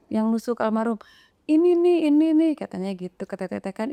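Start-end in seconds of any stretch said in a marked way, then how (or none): 1.5-2.5 higher, louder retold accusation; quick repeated jabs, voice raised; urgent pointing out. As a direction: imitate someone urgently singling out the culprit.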